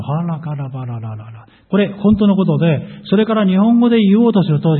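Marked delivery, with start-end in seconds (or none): 0.0-1.5 soft rolling tongues in praise; speaking in tongues. Shown as a demonstration. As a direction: murmur rolling syllables as quiet praise. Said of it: "で、賛美になる". (賛美として静かに転がる異言)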